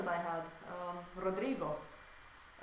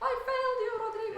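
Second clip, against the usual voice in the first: mock dramatic; 14 semitones higher, 6 dB louder, faster than usual. Mock lament to student.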